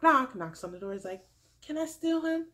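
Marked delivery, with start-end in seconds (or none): 1.6-2.5 lightly imitated high-pitched request; sweet interrupter. Crystal interrupting the date, lightly imitated chirp. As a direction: pipe up higher, cutting in.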